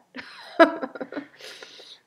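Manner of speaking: high breathy laugh. Real, not acted. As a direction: let a real laugh slip out. Laughs at own whining.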